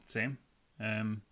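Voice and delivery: usual speaking voice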